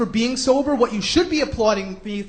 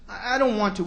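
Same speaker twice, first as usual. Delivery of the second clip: soft, stammering mock guest; reluctant guest; 3 semitones lower, 6 dB quieter than usual. Guest refusing the TV host, soft mock reluctance. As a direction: shrink back hesitantly. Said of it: "He gets some guy in Oprah".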